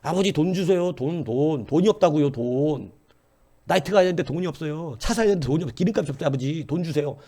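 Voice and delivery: imitated son begging, drawing out the plea; pestering spoiled son. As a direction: nag father like a demanding son. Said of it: "아들놈이 와가지고 아버지 돈 많으니까 돈 내놓으라".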